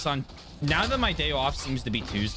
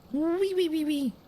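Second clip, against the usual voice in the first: high squeaky wee wee like a cat eating; happy eating cat noise; 13 semitones higher than usual. Shown as a demonstration. Streamer imitates happy eating cat noise, high squeaks. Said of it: "Happy eating cat noise".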